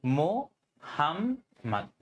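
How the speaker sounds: Mohammad spoken very slowly in three beats; syllable by syllable. Shown as a demonstration. Teacher counting the syllables of a student's name, very slow. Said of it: "কয়টা সিলাবল বা কয়টা অক্ষর".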